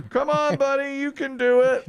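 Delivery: squeaky high cheering voice; high mock cheer. Repeating girlfriend's line mockingly.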